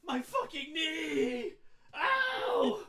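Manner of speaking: loud, slow, laughing yell of pain; comic cry of pain over a knee; mock pained yell. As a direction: howl it through laughter like a hurt knee. GM acts out painful knee cry.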